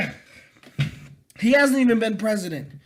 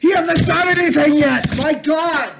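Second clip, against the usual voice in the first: raised, higher-pitched angry outburst; exasperated shouting; 5 semitones higher than usual. Real, not acted. Ranting at people scared of Trump, exasperated shouting. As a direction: explode in exasperated disbelief.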